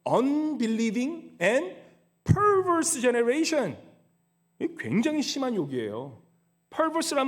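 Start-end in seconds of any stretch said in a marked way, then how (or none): 0.0-3.8 each English word stretched out very slowly; slow English quote. Shown as a demonstration. Pastor reading the English verse to the congregation, very slow. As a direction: pronounce the English verse syllable by syllable. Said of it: "영어로 보시면 뭐라고 하냐면".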